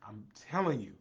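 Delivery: usual speaking voice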